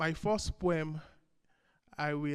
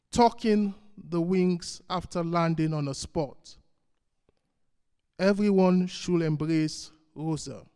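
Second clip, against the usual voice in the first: emphatic opening words, deliberate pauses between lines; poetry reading; 5 dB louder than usual. Acted. Poet reads tribute to Rosa Parks, measured recital. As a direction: recite line by line to a room.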